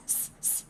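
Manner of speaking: S, s hissed without voice; unvoiced hiss. Shown as a demonstration. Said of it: "Like mess".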